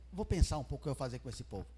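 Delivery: low, soft, quick musing; pondering aloud. Acted. Preacher voicing God thinking over the people, subdued musing. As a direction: toss it out low, as if to yourself.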